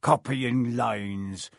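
very slow scoff at copying lines; scornful repeat. Acted. Hagrid mocking Malfoy's idea, very slow and scornful. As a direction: drag out copying lines with contempt.

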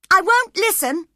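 put-on a young girl flaring up in defiance; defiant refusal. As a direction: cut him off before he can speak. Cathy refusing Heathcliff.

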